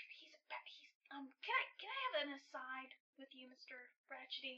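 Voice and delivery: hushed, near-whispered request; hesitant, very soft confidential murmur; discreet aside. Acted.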